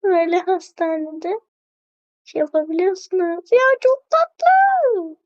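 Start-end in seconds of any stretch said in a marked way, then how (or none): 3.5-5.2 gushing. Real, not acted. Gushing over cute item.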